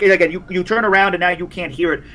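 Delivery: ordinary talk, nothing marked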